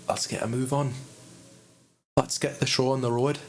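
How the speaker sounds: lightly put-on Scottish accent; a playful stab at a Scottish accent; a hint of Scottish accent. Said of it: "To get into the Scottish accent".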